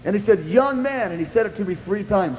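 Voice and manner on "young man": harsh rebuke. Retold or imitated.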